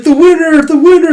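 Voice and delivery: high, loud victory shout; hammed-up ring announcer cry; triumphant announcement. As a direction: proclaim the champion at full voice. Retold announcing winner, arm raised.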